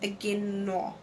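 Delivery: "Agino" sounded out softly, drawn out at the end; trial pronunciation. Shown as a demonstration. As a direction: feel out "Agino" quietly, lingering on the final vowel. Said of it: "How do I pronounce it?".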